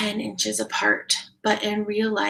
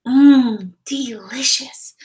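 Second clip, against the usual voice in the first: loud mmm, then a slow delicious; savoring; 4 semitones higher, 9 dB louder, slower than usual. Acted. Layla eating pancakes with eyes closed, savoring. Sleepy girl tasting pancakes.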